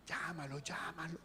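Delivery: hushed scared plea. Pretend. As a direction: whisper from under the blanket. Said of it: "ni se mueven". (susurro asustado)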